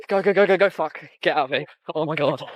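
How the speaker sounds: real panicked urging, pitched high. Real, not acted. Telling friends to get out of here, panicked. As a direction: urge everyone to run, high and frightened.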